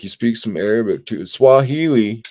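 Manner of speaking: ordinary talk, nothing marked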